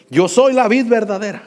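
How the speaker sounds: loud, high proclaiming preacher; preaching at full force. Acted. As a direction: ring out the verse from the pulpit. (predicación a todo pulmón)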